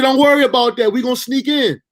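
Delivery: lightly loud, high cousin pep talk; cousin's encouragement. Retold or imitated. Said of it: "He said".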